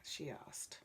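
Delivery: usual speaking voice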